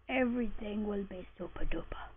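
soft whispered aside; playful unvoiced whisper. Real, not acted. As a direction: breathe it as a happy secret. Whispered aside after torches.

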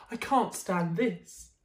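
slow, fed-up complaint; weary exasperation. Acted. Mister Bear fed up with the noise, slow weary complaint. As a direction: drag out each word in despair.

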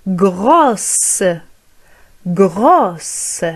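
grosse with a clear S at the end; final s sounded. Shown as a demonstration. Teacher drilling students on grosse with sounded S. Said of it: "نضيف اس او".

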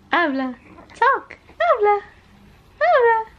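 high coaxing calls; coaxing baby talk. Acted.

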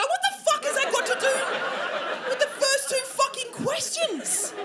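very high, squealed outrage; exasperated high-pitched rant; outraged retort. Retold or imitated. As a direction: squeal it in comic disbelief. Outraged reply to irrelevant question.

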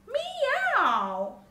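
high, loud drawn-out meow; cat's meow. A narrator's reading. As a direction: meow out boldly like a cat. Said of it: "said the cat".

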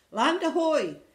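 high, loud lookout call; excited storybook pirate shout; crying out. Acted. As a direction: sing out across the deck. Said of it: "cried Violet".

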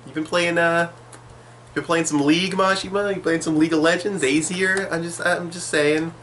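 high, sing-song teasing voice; mock teasing. Teasing the author Mashima about playing League, high mock voice.

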